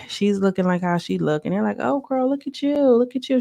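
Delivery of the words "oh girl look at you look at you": high, cooing mock admiration; gushing greeting. They greet Diamond as she comes in, high gushing imitation. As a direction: imitate friends fussing over her outfit, pitched up. Acts out friends greeting a newcomer.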